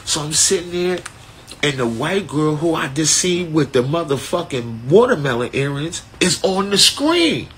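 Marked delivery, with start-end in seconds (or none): none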